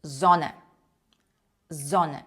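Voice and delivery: Зоне starting with a voiced z; German Sonne with a z onset; S read as Z. Shown as a demonstration. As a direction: buzz the first sound of Зоне. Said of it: "Літера С читається як український звук З".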